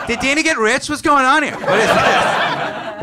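loud baffled questions; baffled onlookers. Retold or imitated. Strangers loudly baffled by the couple. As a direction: demand it in disbelief. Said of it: "everybody just goes".

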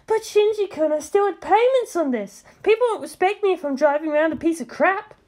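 pouting high complaint; whiny Misato. A narrator's reading. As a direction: whine about the wrecked car. Misato whining.

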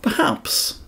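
high, breathy teasing perhaps; mocking. Elijah mocking the prophets of Baal, teasing. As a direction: tease the prophets. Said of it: "mocked Elijah".

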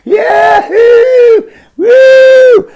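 high wild whooping yell; gleeful whoop. Retold or imitated. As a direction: whoop like a stoned friend cheering. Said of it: "looking at me like".

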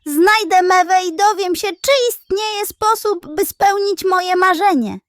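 high and loud, bursting with eagerness; the plan pours out in a rush of joy; thrilled. Acted. Foncia rushes off thrilled.

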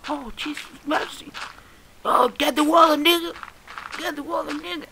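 high-pitched mimicry building to a yell; imitating video shouters. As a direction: mimic a panicked cry in a squeaky-high voice. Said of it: "esa frase que estoy viendo".

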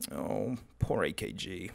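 soft, low, slow lament; mock pity. Reviewer mourning a dead headphone brand, mock pity.

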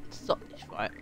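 normal talking voice

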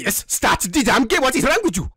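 put-on high, agitated African accent; indignant outburst in a put-on African accent. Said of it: "so you don't have an African accent".

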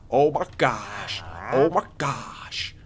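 slow, drawn-out whispery awe; hushed awe. Real, not acted. Gamer gazing at the traveling merchant, hushed awe. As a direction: stretch it out in a whispered marvel.

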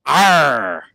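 loud, bold pirate arr; loud pirate growl. Acted. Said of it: "Give me your best".

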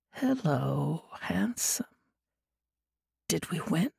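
breathy, hushed half-awake murmur; soft sleepy mumble. Acted. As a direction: murmur it softly, barely awake. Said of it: "Des mumbled softly".

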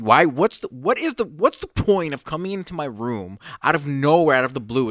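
mildly heated retold protest; retold exasperation. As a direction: demand an explanation as you retell it. Retold exasperated challenge to mom.

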